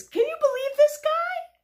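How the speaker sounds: high, loud incredulous outburst; exasperated pigeon. Acted. Pigeon complaining about the duck, exasperated. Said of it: "Look how mad his face is".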